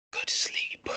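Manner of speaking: soft, unvoiced whisper; whispered spell. Priest casts sleep on Gerbil, whispering. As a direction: whisper it like an incantation.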